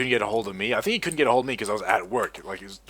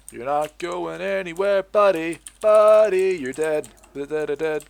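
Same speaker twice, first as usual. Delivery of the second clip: higher, slow, sing-song taunt at the fleeing player; gloating taunt; 6 semitones higher, 5 dB louder, slower than usual. Real, not acted. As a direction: toy with him before the kill. Taunting enemy player in game.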